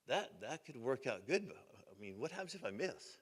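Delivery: hushed, halting acted reply; hesitant doubt. Roper hearing the plan for grandma's roses, hesitant doubt. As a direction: voice a worry softly, unsure of the plan.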